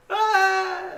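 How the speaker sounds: high, loud mock scream; crowd's panicked scream. Game master voices bystanders running away, panicked scream. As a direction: scream like a fleeing onlooker. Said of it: "everybody kinda looks at you like".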